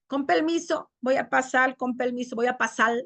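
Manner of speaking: "permiso" as pelmiso, "pasar" as pasal; lambdacism. Shown as a demonstration. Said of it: "en vez de la R, va la L".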